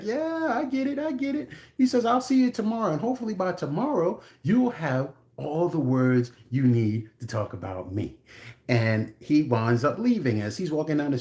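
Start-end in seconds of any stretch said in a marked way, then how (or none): 0.0-8.2 high, light put-on voice staying calm; calm dismissal. Husband calmly walking out on the raging woman. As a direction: brush her off coolly, in a high voice. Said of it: "He's real calm".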